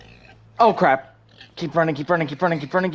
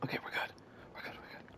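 relieved hushed whisper; 24 dB quieter, faster than usual. Real, not acted. Hiding, relieved.

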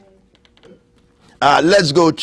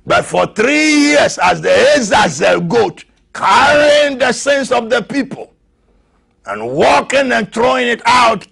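high, loud preacher's cry; climbing fervent sermon shout; preaching at full force; 11 semitones higher, 4 dB louder than usual. Acted. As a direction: drive it home like a revival preacher. Preaching climax on the cross.

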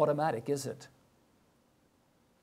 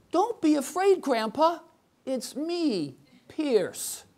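put-on higher, reassuring little-boy voice; toddler voice; 9 semitones higher, 6 dB louder, slower than usual. Toddler reassures his frightened grandpa, higher childlike voice. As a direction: play the two-year-old comforting grandpa.

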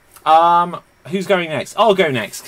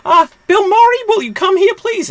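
very high, squealing mock panic; shrieky pretend damsel in distress; hammed-up panicking mother; 6 dB louder than usual. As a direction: cry out in falsetto for help. Acts panicking mother in film retelling.

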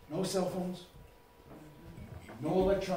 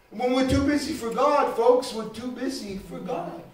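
usual speaking voice

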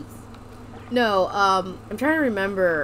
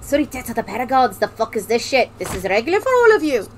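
Scottish dwarf. Retold or imitated. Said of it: "I don't know why he's Scottish".

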